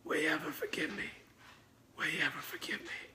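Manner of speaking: hushed, hurried plea; Burt Reynolds whispering. Retold or imitated. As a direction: whisper it right into his ear. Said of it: "puts his lips by my ear".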